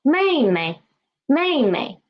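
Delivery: 妹妹 with falling first 妹, low light second; teacher's model 妹妹, second 妹 short and low. Shown as a demonstration. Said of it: "it will be lower".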